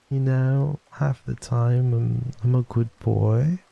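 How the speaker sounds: high, slow, teasing character voice; Astarion. Acted. Character denies edging himself, drawn-out impression. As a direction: draw out the denial in a high, coy voice. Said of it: "done a pretty good impression of him".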